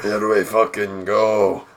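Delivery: weary resignation. Retold or imitated.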